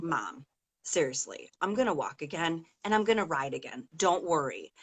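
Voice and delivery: calm her mother down softly; gentle reassurance. Retold or imitated. Injured daughter reassuring her mom, softly and gently.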